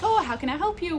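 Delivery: high-pitched, chirpy shop greeting. Retold or imitated. Shop assistant greeting a customer she takes for Italian, chirpy. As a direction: imitate an over-friendly shop assistant's lilt. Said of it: "chirpy, being like".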